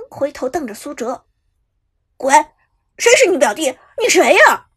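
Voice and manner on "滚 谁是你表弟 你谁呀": angry retort. A narrator's reading. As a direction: spit it back at him. Cousin glares and snaps back. (表弟怒瞪着回怼)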